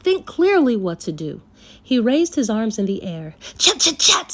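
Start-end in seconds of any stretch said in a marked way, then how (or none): 3.6-4.3 higher, louder yell at monkeys. Acted. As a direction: shoo the monkeys away. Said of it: "he yelled".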